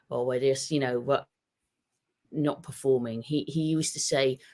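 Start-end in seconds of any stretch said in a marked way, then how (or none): none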